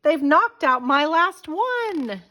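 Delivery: high, slow dismayed cry; distressed wail. A narrator's reading. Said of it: "George shrieked".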